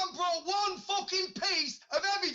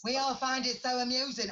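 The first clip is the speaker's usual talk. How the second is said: mock British accent echo; 2 semitones lower than usual. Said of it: "I find your fucking accent amusing".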